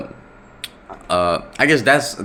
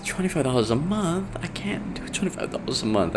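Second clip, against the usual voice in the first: high, soft mock protest; imitating reluctant donor; 9 semitones higher, 5 dB quieter than usual. College girl balking at a monthly donation, imitated reluctance. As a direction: put on a small, high voice pleading she can't. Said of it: "She said, "Oh".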